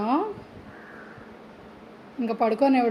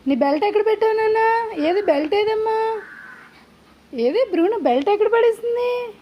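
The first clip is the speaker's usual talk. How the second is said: much higher, sing-song questions; 10 semitones higher than usual. Real, not acted. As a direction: coo the questions at the dog. Asking dog for its belt.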